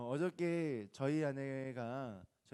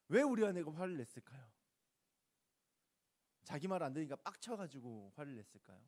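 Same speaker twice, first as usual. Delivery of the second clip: ordinary talk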